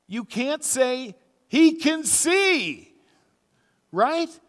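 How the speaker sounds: high, loud, slow disbelief; incredulous outcry. Retold or imitated. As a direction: stretch out the amazement. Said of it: "And I'm sitting there going".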